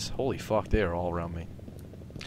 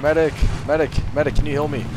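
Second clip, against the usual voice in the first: loud urgent call for a medic; man down shout; 5 semitones higher, 4 dB louder than usual. Real, not acted. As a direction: yell for help while downed. Downed, calling for a medic.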